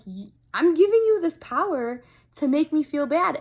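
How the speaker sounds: catching herself giving her power away; exasperated realization. Retold or imitated. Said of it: "So I'm like".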